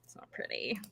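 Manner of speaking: grim hushed whisper. Real, not acted. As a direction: drop to a bleak whisper.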